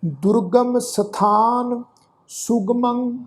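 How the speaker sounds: slow, sustained sacred verse; measured Gurbani recitation; scripture recitation. Shown as a demonstration. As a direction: recite slowly, holding the long vowels.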